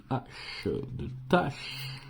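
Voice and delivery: à peine soufflé, sans voix; chuchoté. Real, not acted. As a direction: murmure le mot du bout des lèvres. Lit une lettre grattée. (« de tâche » soufflé sans voix, presque inaudible)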